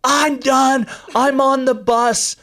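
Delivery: high, drawn-out mock hysterical cry; retold hysterics. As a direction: imitate a worn-out runner phoning in hysterical. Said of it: "She calls me hysterical".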